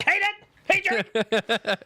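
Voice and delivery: squeaky high-pitched call; silly high-voiced greeting; high goofy voice. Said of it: "He's like".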